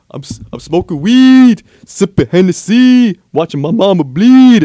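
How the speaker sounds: loud, punched-out rapper impression; hard, loud mock rap delivery. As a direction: belt each line, drawing out the last word. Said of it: "But [name] is like".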